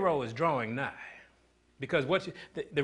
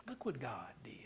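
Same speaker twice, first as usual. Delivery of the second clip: whisper; 2 semitones lower, 14 dB quieter than usual. Acted. Hushed repeat.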